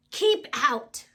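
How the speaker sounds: loud barked warning; keep out shout. A narrator's reading. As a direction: call it out like a warning sign. Sign shouted in story.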